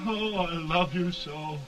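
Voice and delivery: loud, slow grieving love; anguished declaration. Acted. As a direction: cry out love in grief. Lovers' final farewell.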